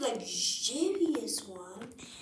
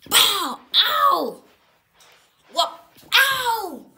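yelps of pain; 11 semitones higher, 13 dB louder, slower than usual. Acted. Fight pain cries.

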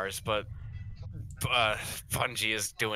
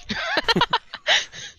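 high squeaky laugh; 6 dB louder than usual. Real, not acted. Laugh after shout.

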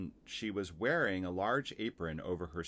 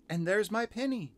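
put-on very high, louder child's exclamation; excited cry; 13 semitones higher, 5 dB louder than usual. A narrator's reading. Girl spotting her lost pinny, high excited cry. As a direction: pipe it out high and loud. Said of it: "cried Lucy".

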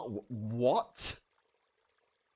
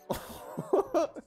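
high giggle. Real, not acted. Laugh at letter.

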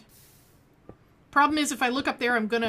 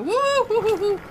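high excited hoo hoo hoo; chimp hooting; 11 semitones higher, 7 dB louder than usual. Real, not acted. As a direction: hoot like a monkey. Falling in game, hooting.

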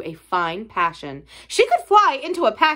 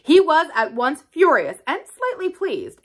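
ordinary delivery

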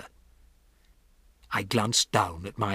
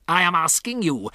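raised, insistent witch voice; imperious, higher-pitched demand; 7 semitones higher, 4 dB louder than usual. A narrator's reading. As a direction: press the listeners for an answer.

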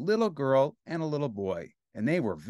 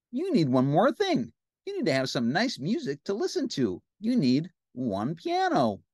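high, eager cartoon helper; Ernie; 4 semitones higher than usual. Ernie suggests music for the bath, high cartoon voice. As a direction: suggest it brightly, pitched up. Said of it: "said Ernie".

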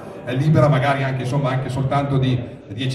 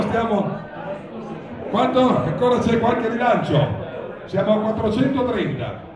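raised, ringing bid calls; auctioneer calling bids; 8 semitones higher, 3 dB quieter than usual. Real, not acted. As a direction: call out each bid to the room.

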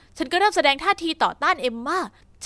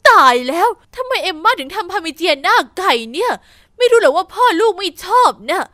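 loud, dismayed outcry, slowing at the end; a fussing mother shouting in the kitchen; alarmed shout; 3 semitones higher, 8 dB louder than usual. Acted. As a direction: cry out in shock over the dish. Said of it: "จู่ๆแม่ก็ตะโกน".

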